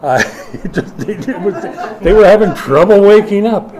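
ordinary delivery

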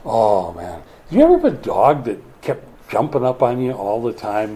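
ordinary talk

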